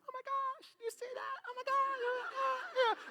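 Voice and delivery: very high, hushed mock squealing; imitated excited onlookers. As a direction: squeak softly like an abuzz crowd. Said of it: "everybody around us starts going like".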